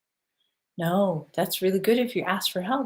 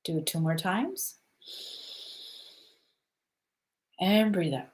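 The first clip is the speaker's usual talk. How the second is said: guided breathing. Acted. Leads a grounding breath exercise.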